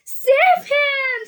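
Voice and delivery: very high, loud scream. A narrator's reading. Mrs. Gloop as her son sinks, screaming high. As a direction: scream it out in panic. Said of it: "screamed Missus Gloop".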